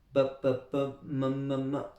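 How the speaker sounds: short, separate babble syllables on "pa" and "ma"; baby's first sounds. Shown as a demonstration. Teacher shows learners a baby's first sounds, as separate babbled syllables. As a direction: sound out "pa, pa, pa, ma, ma, ma" one by one. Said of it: "il va faire ses premiers sons".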